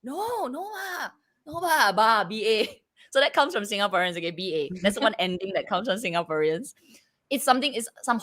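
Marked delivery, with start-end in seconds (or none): 0.0-2.8 Singaporean correction. Retold or imitated. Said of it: "they're like".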